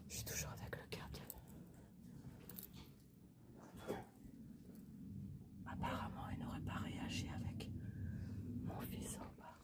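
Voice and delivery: soft unvoiced whisper throughout; library whisper. Real, not acted. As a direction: keep it hushed, exploring in the dark. Whispering while exploring the house.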